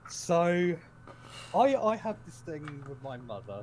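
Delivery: usual speaking voice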